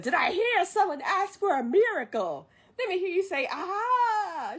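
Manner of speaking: high, loud theatrical proclamation; Mushu's grand entrance. Retold or imitated. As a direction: call out like a showy revival preacher. Voices Mushu's grand entrance as a preacher.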